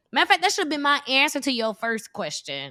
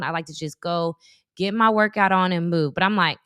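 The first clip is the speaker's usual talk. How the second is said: ordinary talk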